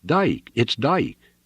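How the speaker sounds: high-pitched cry of recognition; excited shout. A narrator's reading. Lookout shouting who is coming, excited shout. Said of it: "he shouted".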